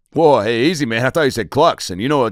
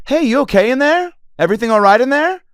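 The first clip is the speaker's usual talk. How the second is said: high, loud sing-song check through the door; mock stewardess calling loudly over the plane noise; flight attendant; 6 dB louder than usual. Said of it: "Flight attendant".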